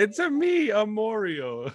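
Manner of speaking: high-pitched mock video-game catchphrase; Mario. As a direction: laughingly put on a high cartoon plumber voice. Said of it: "Mario's distant cousin".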